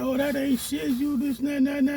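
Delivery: high mocking voice; mimicking his girlfriend. Girlfriend belittling everything he does, high mock. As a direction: brush him off in a high sneer. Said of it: "She's like".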